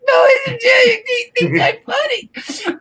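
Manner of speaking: high, slow, sobbing wail; hysterical crying. Retold or imitated. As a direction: cry it out, laughing through tears. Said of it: "hysterical crying".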